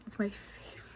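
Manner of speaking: soft, choked-up whisper; emotional whisper. Real, not acted. Vlogger overcome talking about her favorite band, whispering. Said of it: "Sorry, I'm getting emotional".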